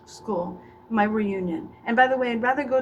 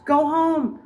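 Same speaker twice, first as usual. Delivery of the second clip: shouted dismissal; 6 semitones higher, 7 dB louder than usual. Acted. Shouting audience away.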